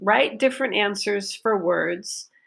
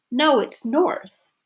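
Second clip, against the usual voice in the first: north higher and slow; stress on north; 6 semitones higher, slower than usual. Shown as a demonstration. Teacher models a disagreeing answer, stress on north. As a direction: correct them by hitting north. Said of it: "north is my focus".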